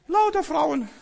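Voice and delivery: high, loud comic disbelief; hammed-up surprise; mock dismay. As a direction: squeal it in mock shock. Mock dismay at only women.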